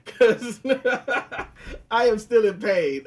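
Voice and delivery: laughing through pain. Real, not acted. Host laughing to his cohost about his hurt knee. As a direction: laugh it out at your own sore knee.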